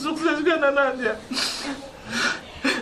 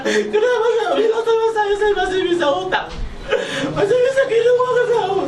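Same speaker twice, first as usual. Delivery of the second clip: mother scolding; 10 semitones higher than usual. Retold or imitated. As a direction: play Mama telling off her son. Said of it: "Mama marah". (meniru Mama memarahi)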